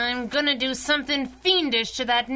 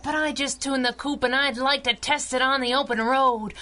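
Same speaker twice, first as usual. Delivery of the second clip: ordinary talk, nothing marked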